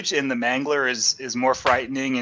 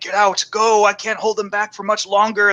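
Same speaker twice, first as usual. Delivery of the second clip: higher-pitched retold plea to flee; mock urgent warning; 6 semitones higher than usual. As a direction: imitate a man urging them out. Acts possessed man's urgent warning.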